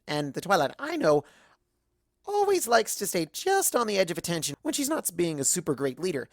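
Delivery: plain reading voice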